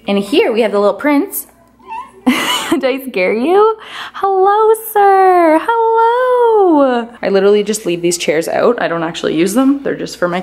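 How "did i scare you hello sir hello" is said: high, loud cooing greeting to the cat. Real, not acted. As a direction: sing hello to the little prince.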